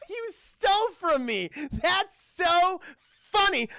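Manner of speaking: high, drawn-out mock gushing, loud; gushing admirer. Woman fawning over the bad boy who stole food, mock gushing. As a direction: squeal it slowly like a hammed-up smitten fan.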